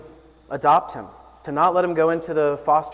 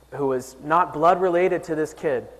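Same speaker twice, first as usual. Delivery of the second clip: ordinary delivery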